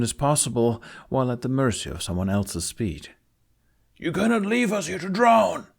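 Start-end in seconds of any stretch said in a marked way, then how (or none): 4.0-5.7 high, loud, pleading cry; frantic plea from the water; desperate captain. A narrator's reading. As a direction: beg not to be abandoned.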